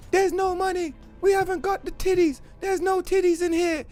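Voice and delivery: high put-on pleading worker voice; whiny office worker. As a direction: squeak it like a cornered clerk. Voicing the scared office worker.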